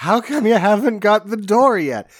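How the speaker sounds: high-pitched comic nagging; mock impatient wife. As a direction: play the nag, voice pitched high.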